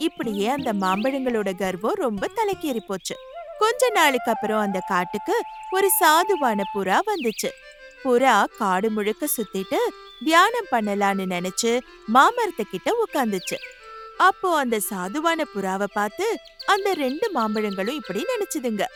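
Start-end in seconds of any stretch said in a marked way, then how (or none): none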